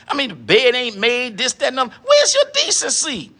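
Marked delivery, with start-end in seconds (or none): 2.0-3.3 high squeak. Acted. Repeated question, first take squeaked.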